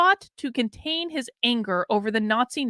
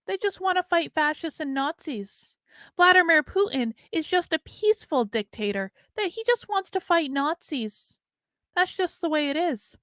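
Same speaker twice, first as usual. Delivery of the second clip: mock innocent; 5 semitones higher than usual. Mocking Antifa and Putin as peaceful.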